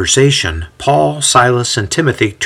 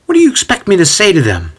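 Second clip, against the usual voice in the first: high, quick defensive question; rattled, self-justifying challenge; 8 semitones higher, faster than usual. A narrator's reading. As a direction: protest it defensively and fast. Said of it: "Sosthenes inquires defensively".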